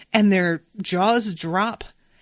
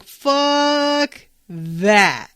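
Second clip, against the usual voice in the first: defiant retort; 6 semitones higher, 5 dB louder than usual. Retold or imitated. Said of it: "And Mabel's like".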